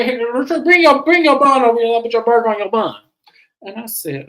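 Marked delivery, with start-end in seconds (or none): 0.0-2.9 high, loud mock holler; calling out. Cook calling a friend over with her bun, high loud call. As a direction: call across the room in a raised pitch.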